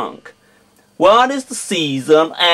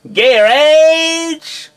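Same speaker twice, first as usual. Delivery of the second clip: "Garage." in American accent, KEH-redge, pitched high; 10 semitones higher than usual. Shown as a demonstration. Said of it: "in my American accent".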